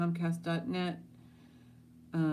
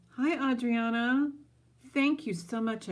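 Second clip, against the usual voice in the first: higher, louder bright welcome; excited greeting; 8 semitones higher, 5 dB louder than usual. Real, not acted. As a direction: greet her with delight. Greeting new viewer excitedly.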